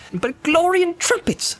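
high joyful cry; cried out. Acted. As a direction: burst out in delight. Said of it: "he cried".

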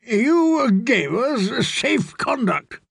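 high, slow, drawn-out outrage; aggrieved lord, stretching the words; indignant protest. A narrator's reading. Guest lurches up to protest.

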